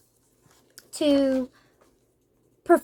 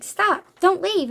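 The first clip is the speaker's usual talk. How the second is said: higher, slowed plea; pleading; 6 semitones higher, slower than usual. Retold or imitated. Villagers pleading with the couple, higher slow plea. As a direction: beg them to stay. Villagers begging her to stay.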